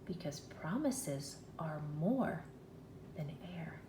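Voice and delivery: low whispered caution; hushed warning. Retold or imitated. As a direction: breathe it out like a secret. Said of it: "So he's telling her".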